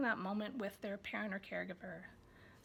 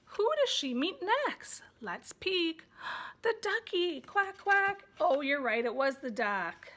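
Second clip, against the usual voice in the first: high, louder, slow question; excited guessing game; 5 semitones higher, 5 dB louder, slower than usual. Shown as a demonstration. Parent playing guess-who with child, in an excited voice. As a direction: make the peek feel exciting. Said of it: "later you're saying".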